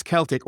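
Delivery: "Celtic" as KELL-tick; hard-C Celtic. Shown as a demonstration. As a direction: start "Celtic" with a K sound. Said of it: "everyone in Britain says".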